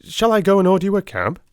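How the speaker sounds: eager cry. A narrator's reading. Watson eagerly offering Holmes a cab, quick and high. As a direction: rush him toward the door. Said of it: "I cried".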